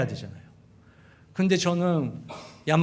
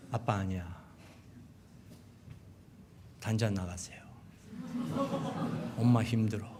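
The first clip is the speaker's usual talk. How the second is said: quiet firm refusal; 7 semitones lower, 12 dB quieter than usual. Retold or imitated.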